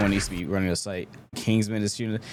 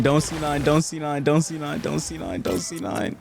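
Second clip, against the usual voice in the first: rapid repeated higher-pitched plea; retold scream; 6 semitones higher than usual. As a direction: imitate a frantic callout, held back. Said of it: "Kingsman was screaming".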